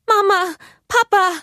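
high, loud, panicked call for help; desperate cry. A narrator's reading. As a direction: cry out in despair. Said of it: "rief Elsa verzweifelt". (verzweifelter, lauter Hilferuf)

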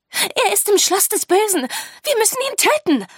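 very high, loud, racing urgency; urgent outburst. A narrator's reading. Sophie urging the teachers to act against the Schoolmaster, urgent. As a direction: burst out with an urgent demand.

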